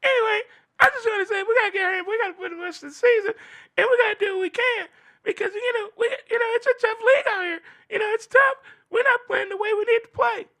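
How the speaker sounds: very high, fast, whiny mock Mahomes; Patrick Mahomes impression. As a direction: squeak it out whining fast like a crying quarterback. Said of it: "what Patrick Mahomes say?".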